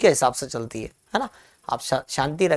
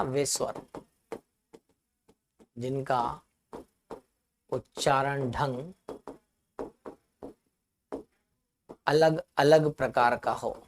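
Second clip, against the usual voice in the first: softer, slow word-by-word dictation; 3 semitones lower, 8 dB quieter than usual. Shown as a demonstration. Teacher dictates the second definition, slow and soft. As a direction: pause between phrases for writing. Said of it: "यहाँ पर लिख लेना".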